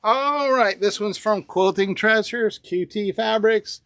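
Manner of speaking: usual speaking voice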